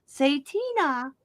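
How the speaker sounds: slow, rising to a high laughing plea; playful coaxing. Acted. Imagining herself begging Nova to say her name, playful coaxing.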